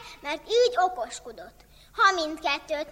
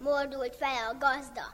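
usual speaking voice

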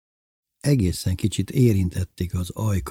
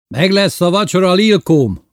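raised, loud boast over the catch; proud announcement; 10 semitones higher, 7 dB louder than usual. A narrator's reading. Showing Lilkóm the catch for supper, proud and loud. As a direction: call it out high and proudly. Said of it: "mutatta büszkén a zsákmányt".

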